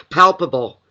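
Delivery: "Palpable" said loud, each syllable pushed out; careful retry. Shown as a demonstration. Reader correcting herself, carefully saying "Palpable". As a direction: nail the word "Palpable" this time, loud and clear. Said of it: "palp-, I can't say it".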